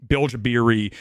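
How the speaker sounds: ordinary delivery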